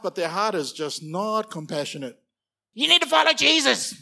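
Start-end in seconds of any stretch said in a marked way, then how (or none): 2.7-3.9 high, loud scolding; angry preacher. Retold or imitated. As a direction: scold them harshly to follow Jesus. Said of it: "Just angry".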